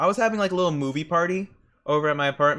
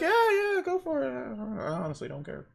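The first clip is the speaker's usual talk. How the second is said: high, dismissive; 9 semitones higher than usual. Retold or imitated. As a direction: wave it off, then trail away. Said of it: "And I'm sitting there like".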